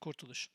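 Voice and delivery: Kurtuluş with KUR left unstressed; Kurtuluş as the concept, no weight on KUR; stress off first syllable. Shown as a demonstration. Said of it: "you don't put the emphasis on the first".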